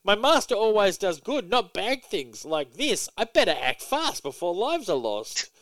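high cartoon dog voice, anxious; a fretting pet reading its thought bubble; earnest hammed-up doggy alarm; worried Crypto. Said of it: "he's just constantly shocked".

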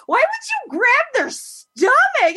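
high, slow, drawn-out disbelief; indignant demand. Retold or imitated. To the guy who grabbed her stomach, high and slow. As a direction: stretch every word out in mock outrage. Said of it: "I'm like".

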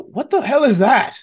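high, startled yell of the crowd. Acted. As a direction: shout it out in alarm. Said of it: "everyone starts to yell".

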